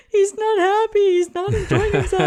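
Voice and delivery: high, loud comic wail; mock bawling. Wife retelling her tearful report to mom, mock bawling. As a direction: wail it out in an exaggerated sobbing voice. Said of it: "I just started bawling".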